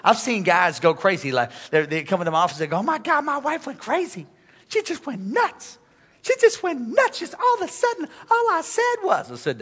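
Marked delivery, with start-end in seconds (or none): 2.8-9.3 high, whining complaint; whiny husband. Retold or imitated. Husband whining to the pastor about his wife. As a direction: whine like a henpecked husband.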